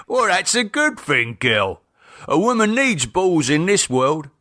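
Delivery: lightly voiced cockney husband; chuckling approval; husband's voice. Keith voiced.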